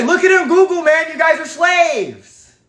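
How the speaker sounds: high, slow taunting voice; hammed-up taunting; mock taunt. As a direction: sneer like a mocker. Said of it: "they say".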